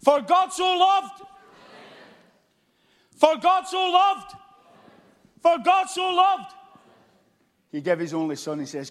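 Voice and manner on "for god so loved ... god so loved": high, loud, slow "For God so loved"; shouted refrain. Shown as a demonstration. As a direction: belt each word so the crowd answers. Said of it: "I want you to shout your name".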